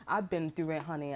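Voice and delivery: normal talking voice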